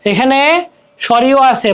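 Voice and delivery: normal talking voice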